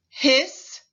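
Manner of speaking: teacher stretching the final s; final hiss. Shown as a demonstration. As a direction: hiss out the end of "Hiss,". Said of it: "Repeat after me".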